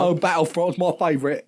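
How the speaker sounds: light mock British accent as Boyega; British accent impression. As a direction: gush like a British fan. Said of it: "Sorry, John".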